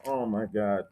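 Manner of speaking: stunned little oh my God; awed aside. Retold or imitated. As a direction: say it under your breath in awe. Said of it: "I'm like".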